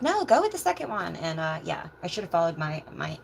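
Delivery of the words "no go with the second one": high-pitched imitation of a pushy bystander; lady behind her. Lady behind her advises on which one to buy, imitated in a high voice. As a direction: mimic the lady, pitched well up. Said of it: "the lady behind me was like".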